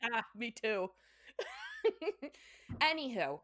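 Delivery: ordinary talk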